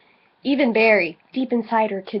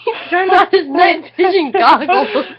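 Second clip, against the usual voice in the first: loud, high, laughing reread; barely getting the words out through laughter; laughing shout; 7 semitones higher, 7 dB louder than usual. Real, not acted. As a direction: shout it out while cracking up.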